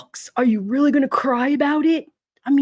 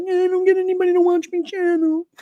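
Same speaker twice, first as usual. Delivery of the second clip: higher, put-on whiny complaining voice; mock whiny streamer; 8 semitones higher than usual. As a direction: mimic her pity-party whine. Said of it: "She's like".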